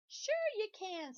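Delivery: high, loud, slow encouragement; pigtail voice. Acted. Pigtail egging Paul on, high and loud. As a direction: egg him on brightly.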